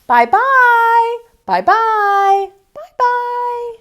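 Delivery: very high, loud sing-song call; calling from afar. Acted. Snow bears calling goodbye to Aluki, high distant call. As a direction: call it out across the ice. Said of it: "a high squeaky voice calling to her".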